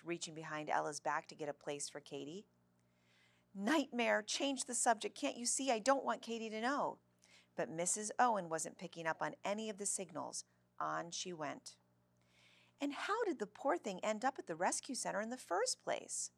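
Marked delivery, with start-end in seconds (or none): 3.5-7.0 high-pitched exasperated inner plea; mildly panicked storybook thought; frantic pleading. A narrator's reading. Ella's panicked silent plea. 12.8-16.3 high, lilting nosy question; oblivious lady prattling on in a high voice; chatty school lady. A narrator's reading. Chatty teacher keeps asking.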